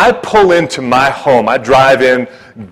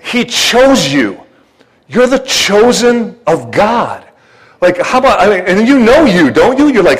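ordinary delivery

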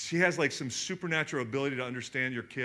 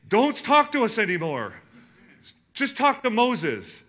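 terrified plea; 8 semitones higher, 8 dB louder than usual. Retold or imitated. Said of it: "they were so terrified".

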